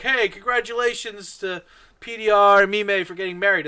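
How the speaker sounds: loud cheery announcement; mock toast. Victor announcing the wedding at the cheers, loud and cheery. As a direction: shout it out like a toast. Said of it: "he's like".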